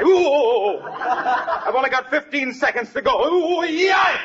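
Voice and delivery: high strained cries of a man on a hot stove; pained whoops. Acted. Wilcox on the hot stove, whooping high in pain. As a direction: strain through the heat, voice jumping high. Sitting on the hot pilot light.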